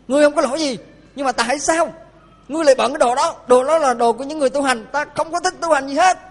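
put-on high, loud stern scolding of an old man; angry scolding. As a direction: scold him like an offended old master. (giọng ông già giận dữ quát mắng)

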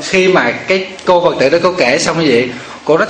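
usual speaking voice

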